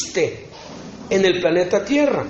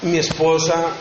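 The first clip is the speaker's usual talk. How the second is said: ordinary talk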